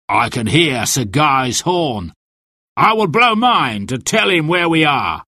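mildly commanding sheriff, rising in pitch; a villain announcing his plan; lightly voiced, pompous sheriff; sheriff character. A narrator's reading. Narrator plays the sheriff.

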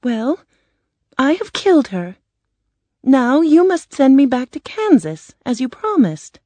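put-on high girl's voice, brave; plucky, high-pitched girl; Dorothy. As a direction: stand up to the wizard in a high, girlish voice. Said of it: "Dorothy said bravely".

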